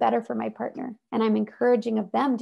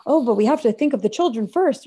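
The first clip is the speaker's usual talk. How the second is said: louder, put-on voice of someone sacrificing themselves; mock martyr voice; 6 dB louder than usual. Mocking people who put the children first, in a louder put-on voice. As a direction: parody the dutiful excuse, hammed up a little.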